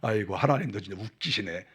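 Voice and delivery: a breathy sigh then a mocking mutter; scoffing Sarah. Preacher voices Sarah mocking God's promise, scoffing. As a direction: scoff as if God were joking. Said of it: "듣다가".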